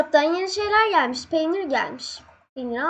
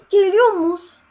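gasp, then a high, loud, slow warning; fearful alarm as the monster nears; frightened gasp; 6 semitones higher, 8 dB louder, slower than usual. Real, not acted. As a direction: gamer spotting the banana coming, alarmed. Banana monster approaching.

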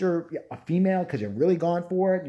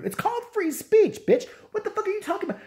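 high outraged shriek; high mocking outrage; incredulous shriek. Real, not acted. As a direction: screech it at her. Shrieking at the author.

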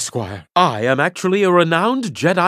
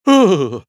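loud sigh; 7 dB louder than usual. Acted. After accepting an odd gift.

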